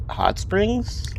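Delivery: high rising disbelief; surprised question. Acted. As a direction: ask it high and soft, amazed. Voicing game character dialogue.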